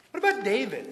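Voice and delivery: normal talking voice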